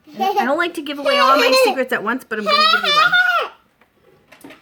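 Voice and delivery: laughing while teasing. Real, not acted. Teasing about giving away secrets.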